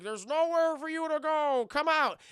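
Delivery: high, loud megaphone-style call; mock police shout. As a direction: bark it out like a cop on a bullhorn. Police calling out.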